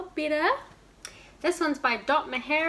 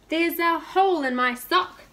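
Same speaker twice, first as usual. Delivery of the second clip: king crying out; 5 semitones higher, 6 dB louder than usual. Acted. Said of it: "he cried".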